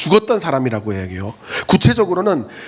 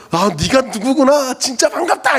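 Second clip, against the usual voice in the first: overly cheerful greeting; 9 semitones higher than usual. Retold or imitated. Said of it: "내가 정말 큰 소리로".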